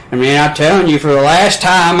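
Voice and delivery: put-on crank the Southern accent up to full bluster; loud mock Southern ranting; aggressive Southern accent. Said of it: "then just, he got aggressively Southern".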